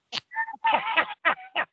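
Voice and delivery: long high-pitched cackling laugh. Real, not acted. Storyteller on being rehired by Roddy, high laugh. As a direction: crack up at the payoff.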